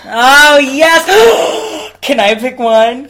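loud, high squeal of delight; real excitement. Real, not acted. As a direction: burst out, thrilled. Delighted at jukebox musicals.

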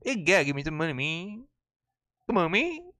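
southern voice. Retold or imitated. Streamer's beggar pleading in a Southern accent. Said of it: "maybe I could do a southern voice".

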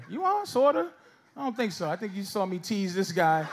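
usual speaking voice

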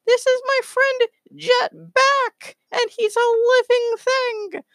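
very high squeaky cartoon critter; tiny high-pitched creature protesting; Space Squirrel. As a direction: squeak it out far above your own pitch, drawn out. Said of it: "Space Squirrel goes".

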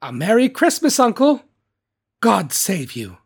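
high, loud, cheery greeting; cheerful. A narrator's reading. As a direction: call it out brightly to your uncle. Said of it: "cried a cheerful voice".

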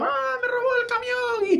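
put-on high wailing little kid whine; crying child. Imitating a crying child who lost his truck, high wail. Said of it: "De repente están llorando".

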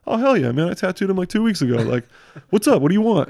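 light up like he knows your buddy; excited tattooer. Retold or imitated. Tattooer recognizes friend's name.

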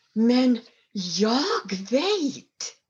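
slow, confident daughter's voice; drawn out and sure of herself; daughter's quiet certainty. A narrator's reading. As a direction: she knows the answer, slowly. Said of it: "sa dottern".

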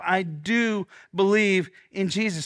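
slow, weighted creed, believe stressed; slow declaration. Shown as a demonstration. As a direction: declare it slowly for the room to echo. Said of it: "Say it with me one time".

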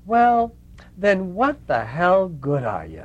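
loud, high, drawn-out Brooklyn challenge; Lena. Retold or imitated. Lena dismisses Mother Teresa, loud Brooklyn lispy voice. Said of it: "in this very thick Brooklyn lispy voice".

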